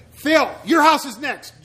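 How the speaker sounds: high, loud hammed-up weather alarm; mock storm warning. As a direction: blare it like a frantic TV forecaster naming each viewer. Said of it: "I'm waiting for the".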